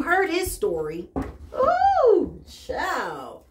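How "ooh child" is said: a high, loud squealing ooh that drops at once; excited squeal. Real, not acted. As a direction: squeal it like juicy gossip. Excited exclamation.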